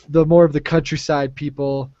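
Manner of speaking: ordinary talk, nothing marked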